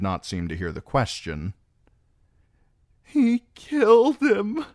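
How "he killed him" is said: high, loud, slow tearful wail; quavery voice. A narrator's reading. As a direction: quaver it out in grief. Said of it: "she said in a quavery voice".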